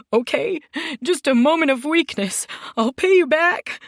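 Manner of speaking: high, loud, tearful begging; crying plea. Acted. Beaten man crying to the boss for mercy. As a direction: sob out the plea, voice cracking high. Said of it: "began to cry".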